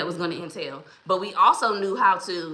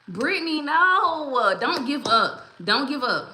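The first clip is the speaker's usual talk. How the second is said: high, louder, drawn-out plea; pleading protest; 6 semitones higher, 4 dB louder, slower than usual. Real, not acted. Host to a viewer who gave up, pleading. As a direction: beg them not to quit.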